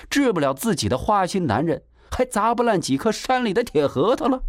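plain reading voice

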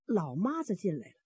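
usual speaking voice

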